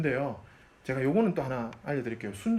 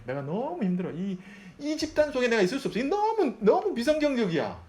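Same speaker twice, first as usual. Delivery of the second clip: exasperated complaint; 10 semitones higher, 4 dB louder than usual. Retold or imitated. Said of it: "이렇게 얘기하는 거예요".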